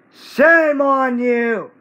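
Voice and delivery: slow, loud and high scolding; angry scolding. Real, not acted. Kid scolding the channel stealing his videos, angry. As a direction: drag it out like a stern telling-off.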